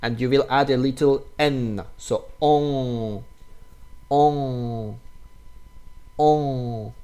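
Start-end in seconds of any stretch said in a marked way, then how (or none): 2.0-6.9 on spoken as nasal vowel, no clear n. Shown as a demonstration. Teacher drilling the nasal on sound. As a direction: round on and send it through the nose. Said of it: "also from nose".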